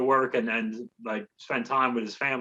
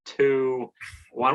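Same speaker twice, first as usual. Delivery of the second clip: normal talking voice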